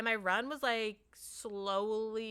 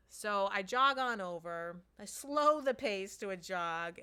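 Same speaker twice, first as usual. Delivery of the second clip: ordinary delivery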